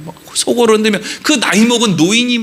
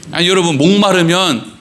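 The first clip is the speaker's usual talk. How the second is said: ordinary talk, nothing marked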